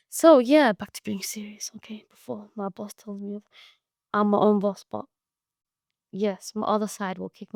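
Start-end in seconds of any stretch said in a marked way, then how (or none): 0.7-3.4 whisper. Pretend. Joking aside, boss scolding.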